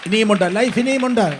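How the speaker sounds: loud, high-pitched rallying proclamation; full-force preaching. Real, not acted. Preacher assuring listeners they and their children will arrive, full-force proclamation. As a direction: build to a forceful sermon climax.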